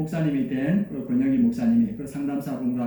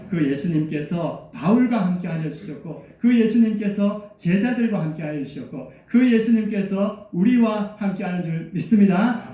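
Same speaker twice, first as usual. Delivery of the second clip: preacher building louder and higher; rising preaching; 3 semitones higher, 3 dB louder than usual. Acted. Pastor proclaiming to the congregation, louder and higher.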